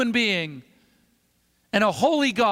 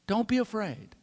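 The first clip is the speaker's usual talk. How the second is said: a softer, tender closing line; soft reassurance; 6 dB quieter than usual. Retold or imitated. Santa calms frightened little Richard, soft and gentle. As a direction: ease the child's fear gently. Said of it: "so that no one else could hear".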